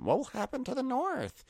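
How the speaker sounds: very high mock-fretful question; Sansa. Sansa fretting about the North, mock high voice. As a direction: put on a high, whiny worry.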